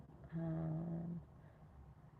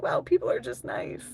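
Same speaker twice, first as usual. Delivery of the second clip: gushing delight; 9 semitones higher, 6 dB louder than usual. Retold or imitated. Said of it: "nice to be like".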